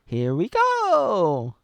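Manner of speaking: slow, loud, high drawn-out cheer; Mario sixty-four imitation. Fan showing how Mario sounded in the sixty-four game, high loud drawn-out cheer. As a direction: belt "Here we go" like the classic game plumber. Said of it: "he says".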